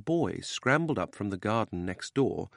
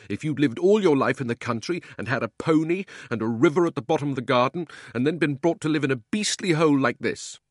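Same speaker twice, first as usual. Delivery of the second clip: put-on loud, higher boyish rant; miserable loud outburst; 6 semitones higher, 6 dB louder than usual. Unhappy boy complains of his new home, loud rant. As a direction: pour out the grievance, loud and wound up. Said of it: "like a boy who was so miserable".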